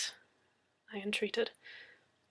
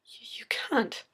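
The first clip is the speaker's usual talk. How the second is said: stunned whisper; 3 semitones higher than usual. Acted. Shocked whisper.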